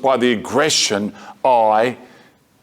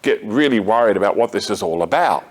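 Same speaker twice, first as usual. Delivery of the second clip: ordinary delivery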